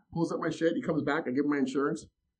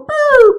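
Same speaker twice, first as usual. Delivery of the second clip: imitated siren blip; 11 dB louder than usual. Police car pulling him over.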